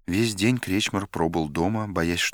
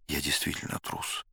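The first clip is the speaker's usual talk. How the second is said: a quiet inner voice, much softer, almost to himself; hushed thought; 3 semitones lower, 9 dB quieter than usual. A narrator's reading. Krechmar admits to himself he is a coward, hushed and much softer. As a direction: say it under your breath as if only thinking it.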